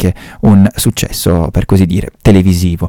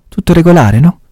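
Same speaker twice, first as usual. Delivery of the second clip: put-on sweetly matter-of-fact old lady; 10 semitones higher than usual. Old lady shows the pact.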